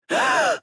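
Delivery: loud, high gasp; shocked gasp. Acted. Explorer seeing a waterfall bleeding, gasping in shock. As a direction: suck in breath sharply, then pant.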